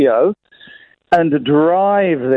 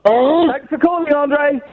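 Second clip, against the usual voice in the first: mock high voice; 8 semitones higher, much slower than usual. Mock send-off to caller.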